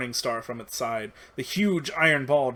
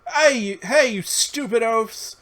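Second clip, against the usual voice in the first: much higher, louder, drawn-out shout; 10 semitones higher, 7 dB louder, slower than usual. Acted. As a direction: yell to draw them off your friend.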